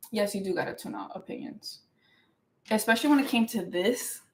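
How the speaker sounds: usual speaking voice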